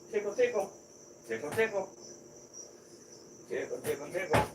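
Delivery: high, soft sing-song tickle talk; playful cooing; baby talk. Acted. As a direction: tease the snake like a baby. Teasing the snake playfully.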